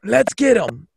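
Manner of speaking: high, fired-up battle cry; rally your buddy to charge; acted hyped-up urging; urgent rallying.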